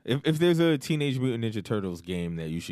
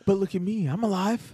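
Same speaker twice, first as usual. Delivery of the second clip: mock ghost taunt; 12 semitones higher than usual. Brother ghost taunts back.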